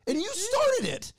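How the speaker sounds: very high, comic indignation; exasperated accusation. Retold or imitated. Husband scolding wife over gym guy, high indignant. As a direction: accuse her at the top of your pitch. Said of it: "I go".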